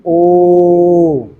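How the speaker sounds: one even chanted o, held two seconds; steady two-second o. Shown as a demonstration. Teacher shows the class a two-second o, steady and even. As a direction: hold the o evenly for two seconds. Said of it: "दो second तक मैंने बोली".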